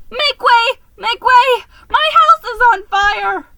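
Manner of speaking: high, loud frantic shriek; squealing make way at the top of the voice. A narrator's reading. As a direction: shove through the crowd shrieking in panic. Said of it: "I shrieked".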